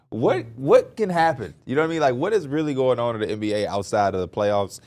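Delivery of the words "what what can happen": mock wonder. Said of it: "And I'm like".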